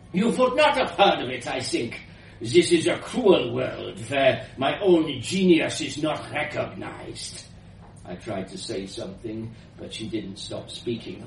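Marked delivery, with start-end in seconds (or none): 0.1-7.4 put-on grand old inventor, slightly foreign; elderly foreign woman. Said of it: "elderly woman's voice".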